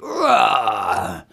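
loud falling mock roar; Papa Bear roar. Papa Bear sees his chair, rumbling roar. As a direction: roar like a tired big bear. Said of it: "and Papa Bear's like".

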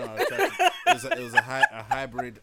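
explaining while laughing high; giggling through the explanation. Real, not acted. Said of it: "laughing at own accent attempt".

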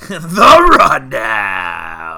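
very loud, drawn-out announcer cry; dramatic shout. Acted. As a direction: bellow it like a trailer voice.

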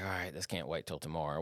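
low, quiet aside, as if to yourself; resigned aside. Retold or imitated. Vet recalls deciding it can't wait till closing time is over, resigned aside. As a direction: drop it low and soft, resigned. Vet voices his own reluctant realization.

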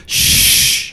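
sharp whispered shush; librarian shush. Acted. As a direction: hush someone loudly. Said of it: "The, the librarian goes".

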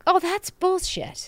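high, slow mock scoff; scoffing dismissal. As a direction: sneer it off as nonsense. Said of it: "you could be like".

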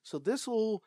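put-on high, slow sweet girl voice; imitating Cat. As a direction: play the eager girl asking. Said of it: "she's like".

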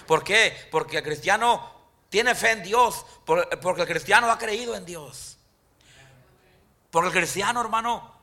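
full-force sermon climax; fiery preaching. Acted. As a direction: lift the congregation with conviction.

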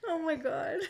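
breathless after laughing; weak from laughing; after laughing hard. Real, not acted. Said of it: "I need to compose myself".

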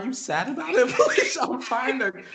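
high-pitched, through giggles; giggly high-pitched questioning; laughing. Real, not acted. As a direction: ask while cracking up. Laughing while asking friend's emotion.

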